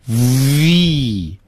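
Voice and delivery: "V" with a long buzzing v and long ee; slow, exaggerated teacher's "V"; V pronunciation demo. Shown as a demonstration. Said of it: "这样来发这个音".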